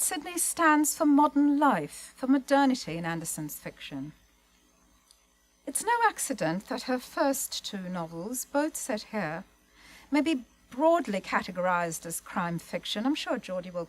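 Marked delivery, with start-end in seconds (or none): none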